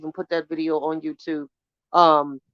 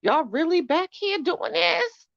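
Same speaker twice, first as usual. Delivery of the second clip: incredulous shock; 10 semitones higher than usual. Retold or imitated.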